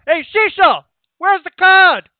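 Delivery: loud, high Italian shout to the kitchen; yelling to the back. Acted. Innkeeper hollering for his wife in the kitchen, loud Italian shout. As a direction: bellow the name across the tavern.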